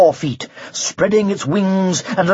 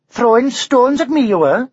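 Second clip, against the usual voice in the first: high, louder Welsh accusation; accusing Welsh dragon; 6 semitones higher, 4 dB louder than usual. A narrator's reading. Dragon accuses the knight, high Welsh lilt.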